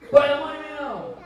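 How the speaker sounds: loud defiant challenge, high and sharp; defiant shout. Retold or imitated. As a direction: shout it like a cocky driver daring the cop. Mocking arrogant driver again. (motorista arrogante gritando com o policial, desafiando)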